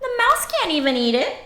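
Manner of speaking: ordinary delivery, nothing marked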